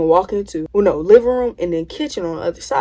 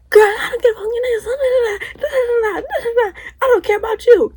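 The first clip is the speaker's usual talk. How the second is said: high laughing mock yell, much higher; mock screaming. Cousin screaming before the mom walks in, laughing mock yell. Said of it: "it went from".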